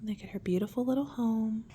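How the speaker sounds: plain reading voice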